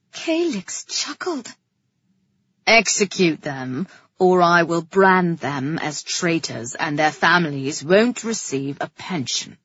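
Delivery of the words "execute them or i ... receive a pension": low, louder, deliberate threat; threatening king. A narrator's reading. King giving his brother an ultimatum, threatening. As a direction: issue it as a royal ultimatum.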